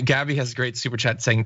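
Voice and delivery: usual speaking voice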